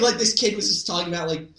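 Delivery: ordinary delivery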